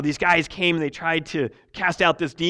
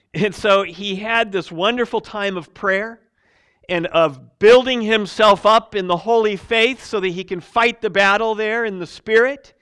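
full-force preaching; 5 semitones higher, 11 dB louder than usual. Acted. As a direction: proclaim victory to the congregation. Preaching retold testimony.

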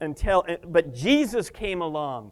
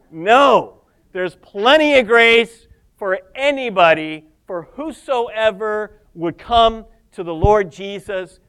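loud, higher-pitched rebuttal; emphatic preaching; 3 semitones higher, 9 dB louder than usual. Real, not acted. Preacher refuting the idea grace runs out, emphatic. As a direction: belt out the reassurance, starting on a punched No. Emphatic answer to imagined refusal.

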